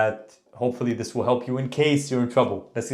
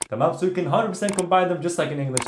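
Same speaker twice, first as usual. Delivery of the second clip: ordinary delivery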